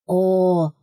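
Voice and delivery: loud sudden cry; exclaimed. A narrator's reading. Second rat exclaiming at the heroine, loud cry. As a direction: cry out in delight. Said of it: "вскричала вторая крыса".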